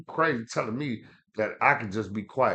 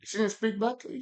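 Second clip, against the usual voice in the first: pitched up high in disbelief; can't believe she stayed silent; incredulous; 10 semitones higher than usual. Real, not acted. Friend asking back in disbelief, high incredulous question.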